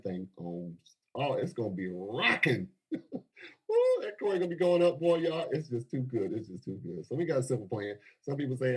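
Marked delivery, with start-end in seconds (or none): 3.7-5.5 excited whoop. Real, not acted. Cheering about coin going up. 6.3-7.0 It's just too good dropped soft and low; softer echo. Real, not acted. Savoring the deal to the crowd, softly repeating it. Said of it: "repeated softer".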